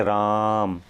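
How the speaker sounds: drawn-out call of the name राम; prolonged call. Shown as a demonstration. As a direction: stretch राम long as if calling someone. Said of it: "किसी को पुकारने में या नाटक के संवाद में".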